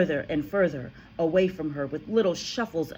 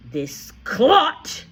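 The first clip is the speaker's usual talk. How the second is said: loud, higher booming insult; booming headmistress scorn; boomed; 8 semitones higher, 10 dB louder than usual. A narrator's reading. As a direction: boom it to the whole hall. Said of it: "bloomed the headmistress".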